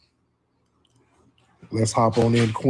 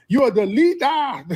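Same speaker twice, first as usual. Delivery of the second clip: much higher, whiny mock voice of the critics; 11 semitones higher than usual. Host mocking critics who blame Tariq, in a high whiny voice. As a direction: mimic the critics as whiny complainers.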